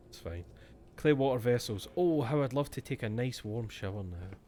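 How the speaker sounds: ordinary talk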